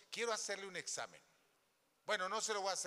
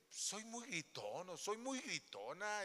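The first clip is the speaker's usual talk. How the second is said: slow asking; 5 semitones higher, 3 dB quieter, slower than usual. Pretend. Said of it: "le va a decir".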